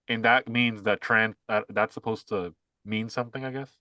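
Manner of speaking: ordinary talk, nothing marked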